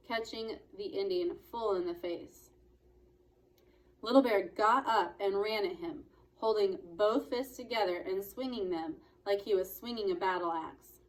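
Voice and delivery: ordinary delivery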